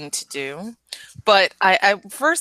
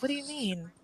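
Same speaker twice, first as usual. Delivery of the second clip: soft, gentle question, much quieter; kindly; 8 semitones higher, 14 dB quieter than usual. Shown as a demonstration. Advising how to ask a believer, soft and kind. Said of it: "generally and kindly".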